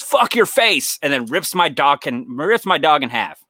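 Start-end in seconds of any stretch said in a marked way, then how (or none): 0.0-1.0 high, hammed-up villain outburst; mock vicious taunt. Intruder to dreamer before ripping dog, mock vicious. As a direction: spit the insult out, pitched way up.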